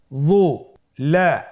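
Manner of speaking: যু then লা split apart; teacher spelling out syllables. Shown as a demonstration. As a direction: break the word into its syllables. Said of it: "ভেঙে ভেঙে পড়তে হয়".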